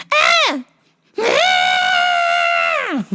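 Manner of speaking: very high mock screech, growing longer; shriek, then hold a long child's wail; imitated tantrum scream. Child rejecting every color a parent offers, mock screech.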